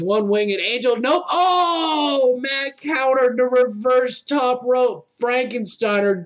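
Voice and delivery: steady live play-by-play calling; wrestling commentator. Real, not acted. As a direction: call each move as it lands.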